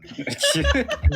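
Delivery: laughing through the words; cracking up mid-sentence. Real, not acted. Player laughing at his own bit.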